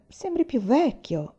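distrustful. A narrator's reading. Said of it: "osservò con diffidenza Aiwan".

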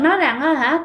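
plain reading voice

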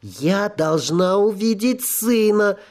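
rising, slow desperate plea; insistent ghost. Acted. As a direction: plead harder each time.

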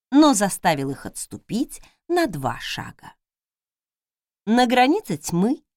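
usual speaking voice

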